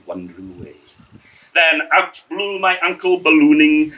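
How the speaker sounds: ordinary delivery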